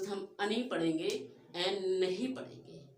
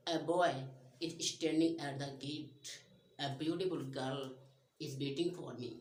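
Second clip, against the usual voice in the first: plain; 7 semitones lower, 3 dB quieter, much slower than usual. Shown as a demonstration. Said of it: "जैसे".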